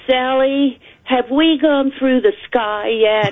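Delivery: put-on a young Aussie girl asking; Australian accent. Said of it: "she said in this wonderful Australian accent".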